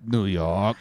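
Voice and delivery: "New York" demonstrated low and soft, with an affected sound; affected local pronunciation. Shown as a demonstration. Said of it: "That's how they say it".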